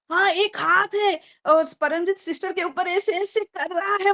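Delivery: high-pitched, thrilled retelling of her own cry; excited shouting. Retold or imitated. Testifying to church sisters, reliving her joyful cry. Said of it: "बोल के मैं चिल्ला रही हूँ".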